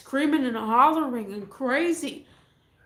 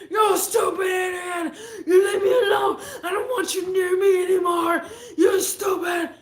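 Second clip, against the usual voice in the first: high, loud crying rant; imitating a screaming meltdown; furious yell; 6 semitones higher, 4 dB louder than usual. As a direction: scream insults through tears. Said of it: "he's all like".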